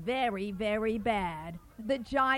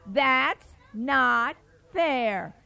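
slow emphatic complaint; slow drawn-out refrain; 5 dB louder, much slower than usual. Acted. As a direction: drag each word out.

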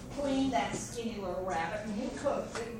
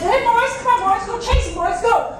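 high, loud panicked shout; frightened shout to the dogs; 11 semitones higher, 13 dB louder than usual. A narrator's reading. Terrified old man shouting at his dogs to give chase. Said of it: "the old man was so frightened".

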